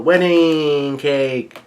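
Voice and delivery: long-held, sliding call; drawn-out mock yell. Big Mom still hollering for her cake, drawn-out mock yell. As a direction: stretch it out like a far-carrying holler. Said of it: "Big Mom continues to yell".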